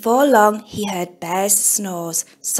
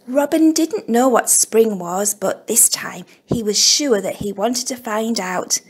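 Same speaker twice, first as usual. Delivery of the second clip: ordinary delivery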